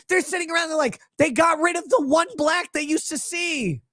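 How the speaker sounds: ordinary talk, nothing marked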